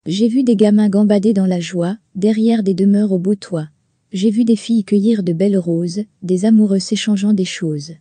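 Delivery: measured, earnest reading of verse; lightly declaimed poem; poem recitation. Acted. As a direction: recite each line like a heartfelt hymn.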